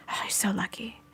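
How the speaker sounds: hushed, jealous whisper; envious whisper. Real, not acted. Envying a viewer's new AirPods.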